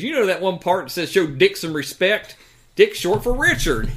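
mocking a podcast host, high and loud; loud mock girl voice. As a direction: ham up the woman explaining, high and loud. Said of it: "She goes".

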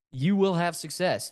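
usual speaking voice

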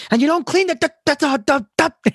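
high, loud stuttering yell; angry mom stuck on a word. Retold or imitated. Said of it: "stutters a little bit".

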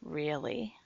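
low, coarse mocking whisper; raspy taunt. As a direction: taunt in a rasp. Sue taunting in eerie voice.

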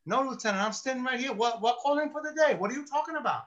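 high-pitched, imitated bewilderment; baffled denial. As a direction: act out protesting innocence, confused. Baffled Rusty denies calling.

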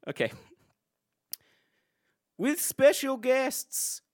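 ordinary talk, nothing marked

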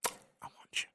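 breathy whispered come-on; mock-seductive whisper. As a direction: whisper it close like a secret invitation. Repeats line as whisper to Sky.

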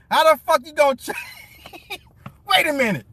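too tickled to finish the question; cracking up. Real, not acted.

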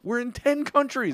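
high-pitched imitated announcement; excited news. Danny texting about early milestones, acted excitement. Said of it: "it was more exciting when it was like".

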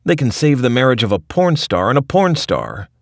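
loud, high cranky rant. Retold or imitated. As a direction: rant like a fed-up grandpa. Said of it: "rants Rick".

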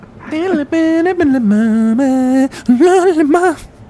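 hearty loud cackle; loud howling laugh. Real, not acted. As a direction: burst into a long howl.

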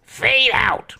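high cartoon duck voice; Daffy Duck voice. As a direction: pop it out like Daffy. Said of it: "He peeks his head out to the left side and says".

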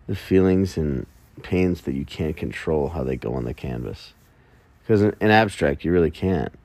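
ordinary delivery

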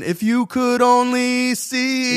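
could with a plain vowel, sung. Shown as a demonstration. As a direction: sing could normally. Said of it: "better than".

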